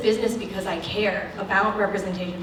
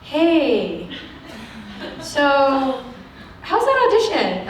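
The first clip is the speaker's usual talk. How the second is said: imitated agent checking in; 5 semitones higher, much slower than usual. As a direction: imitate an agent gingerly asking, pitch jumping up at the end.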